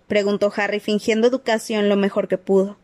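usual speaking voice

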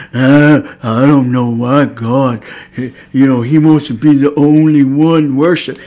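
loud, dragged-out mocking voice; mock someone running their mouth; slow, loud put-on grumbler; mocking prideful complainer. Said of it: "You sound like the devil when you talk".

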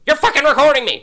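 high, loud shriek of accusation; screaming Karen. Retold or imitated. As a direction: scream it at him like an outraged Karen. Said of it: "She's like a Karen".

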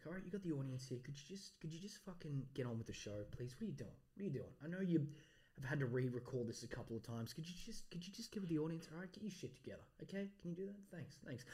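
very quiet off-mic pep talk; hushed self-scolding. Pretend. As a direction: mutter it privately, away from the audience. Private pep talk to himself.

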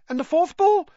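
high-pitched, excited prompt; curious young fan. A narrator's reading. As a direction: jump in eagerly with a high voice. Eager boy keeps asking questions.

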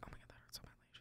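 hushed whisper so mom won't hear; retold whisper. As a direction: whisper the apology under your breath. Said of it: "we're literally, like, whispering".

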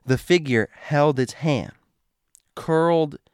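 usual speaking voice

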